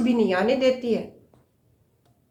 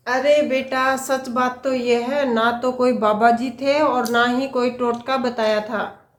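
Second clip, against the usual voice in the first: ordinary talk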